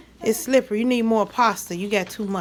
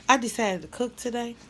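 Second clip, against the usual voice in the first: ordinary delivery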